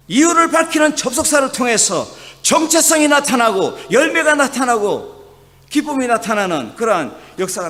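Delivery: rising pulpit fervor; fervent preaching. Acted. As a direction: proclaim it to the congregation. Preaching at full force.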